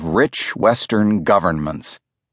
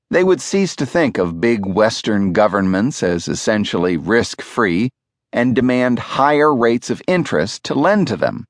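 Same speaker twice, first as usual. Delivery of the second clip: ordinary delivery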